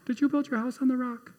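a hint of a little boy pressing his question; insistent child asking again.

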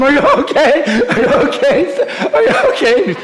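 high frantic repeated asking with a laugh; a father checking on his coughing son. Retold or imitated. Father frantically asking his coughing son, high and breathless.

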